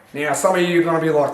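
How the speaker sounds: ordinary talk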